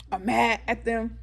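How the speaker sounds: ordinary delivery, nothing marked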